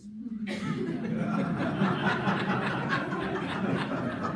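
big hearty laugh at own joke; laughing hard at the punchline; loud burst of laughter. Real, not acted. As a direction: let a real laugh break out. Audience laughter after joke.

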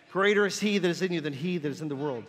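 ordinary delivery

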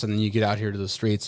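ordinary talk

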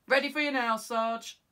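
loud call from outside; muffled loud call to the sergeant; calling through the door. Acted.

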